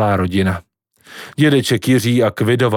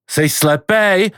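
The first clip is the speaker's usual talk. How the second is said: calling out; 7 semitones higher, much slower than usual. A narrator's reading. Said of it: "volala babička".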